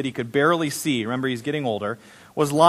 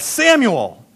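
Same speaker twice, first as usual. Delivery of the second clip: higher, drawn call of a name; 6 semitones higher, 3 dB louder than usual. A narrator's reading. Again the Lord called Samuel, calling out.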